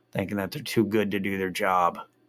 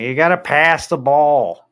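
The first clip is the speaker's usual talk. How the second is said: loud, higher Chicago heckler; Chicago fan accent; 6 semitones higher, 9 dB louder than usual. Retold or imitated. Chicago fan shouting at his team, loud Chicago accent. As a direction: yell at the field like a Chicago dude. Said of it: "like, with the accent".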